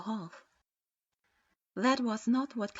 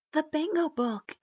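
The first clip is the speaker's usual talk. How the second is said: alarmed; 7 semitones higher, 5 dB louder than usual. A narrator's reading. Genin alarmed.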